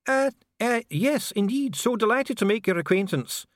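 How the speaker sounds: high, flustered stammer into politeness; caught off guard. A narrator's reading. Dwarf official charmed by a Duchess, caught off guard. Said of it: "caught off guard by the charm offensive".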